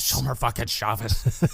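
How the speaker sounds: Walter impression. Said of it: "repeats Walter line".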